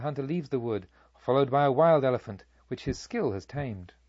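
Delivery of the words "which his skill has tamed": ordinary delivery, nothing marked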